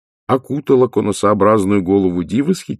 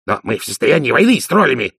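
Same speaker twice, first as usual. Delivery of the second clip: higher, indignant outburst; agitated protest; 6 semitones higher, 3 dB louder than usual. A narrator's reading. Dee protesting the troll to Vimes, agitated. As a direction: object in a flustered rush.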